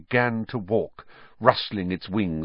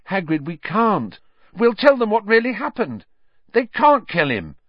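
put-on high, urgent, pleading girl's voice; a frightened girl begging for her friend; pleading young girl; 11 semitones higher, 4 dB louder than usual. A narrator's reading. Hermione pleading with Hagrid.

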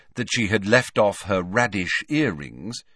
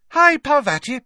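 very high, loud cheery greeting; beaming back brightly; 8 dB louder than usual. A narrator's reading. Hermione greeting Parvati, beaming even more brightly. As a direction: sing out the hello, over-bright. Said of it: "even more brightly".

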